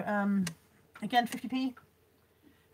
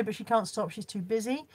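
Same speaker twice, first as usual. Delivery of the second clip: ordinary delivery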